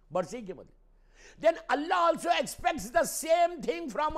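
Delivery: high, shouted exhortation; loud, ringing pulpit cry; impassioned preaching. Real, not acted. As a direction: demand their attention at full force. Preaches at full force.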